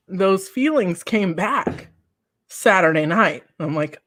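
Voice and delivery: usual speaking voice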